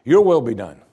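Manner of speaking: "Your" hit loud, the rest falling; stress on Your. Shown as a demonstration. Preacher models a submissive prayer, stressing "Your". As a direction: land hard on "Your", then ease off. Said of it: "The word we should emphasize is your".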